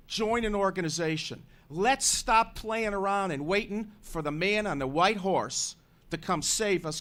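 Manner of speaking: rally the listeners like a preacher; preaching. Acted. Host rallies listeners on air.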